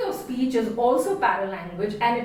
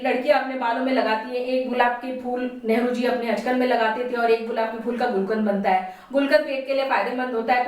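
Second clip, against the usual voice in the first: very fast roadside hawker patter; street medicine seller; much faster than usual. Shown as a demonstration. Teacher showing students a fast roadside medicine seller's pitch. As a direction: rattle it off like a roadside quack selling cures. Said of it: "a little demonstration on fast speaking".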